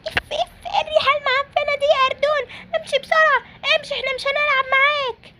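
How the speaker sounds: very high-pitched character voice, put off by a stink; squeaky, repelled little playmate; disgusted friend. Acted. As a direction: shoo a smelly friend away in a high squeaky voice.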